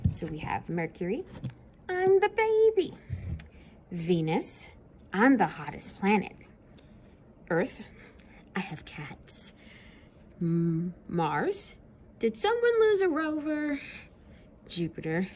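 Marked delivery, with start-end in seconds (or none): 1.9-2.9 high cutesy little voice; high baby voice; baby Mercury. Acted. 8.6-9.5 soft whispered line; whispered secret. Acted. Earth whispers. 12.2-13.8 high puzzled voice; high questioning planet; Mars character. Acted.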